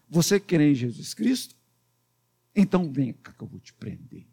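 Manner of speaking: low, softly spoken persecutor; Saulo. Acted. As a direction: question them low, then quietly move to arrest. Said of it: "Era assim que Saulo fazia". (perseguidor falando baixo e grave)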